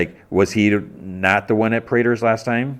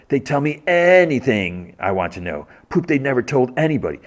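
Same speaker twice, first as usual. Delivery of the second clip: higher, hammed-up practice line; rehearsed line delivery; 6 semitones higher than usual. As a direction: try out a line with put-on energy. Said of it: "practicing saying different lines in different ways".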